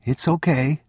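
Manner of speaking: put-on high child's voice; reassuring boy. A narrator's reading. Boy coaxing frightened old man, high reassuring child voice. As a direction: reassure him like a little boy.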